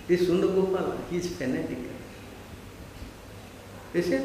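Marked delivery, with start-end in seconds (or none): none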